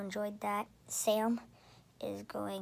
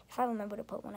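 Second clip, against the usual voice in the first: ordinary talk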